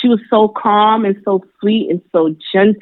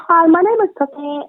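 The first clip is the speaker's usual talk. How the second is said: sweet voice; 10 semitones higher than usual. Retold or imitated. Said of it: "She was like".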